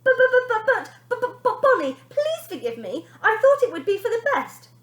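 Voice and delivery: high, loud stammering panic; trembling with fear. A narrator's reading. Frightened mother begging her daughter, high and loud. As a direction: stammer out the plea. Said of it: "trembling with fear".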